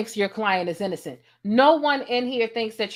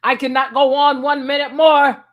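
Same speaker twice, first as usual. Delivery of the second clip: mock; 4 semitones higher, 8 dB louder than usual. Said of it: "I'm like".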